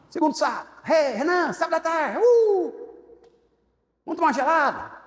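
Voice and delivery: high, loud mocking invite with a whoop; sing-song lure from a drinking buddy; hammed-up party friend; teasing party buddies. Friends tempting again.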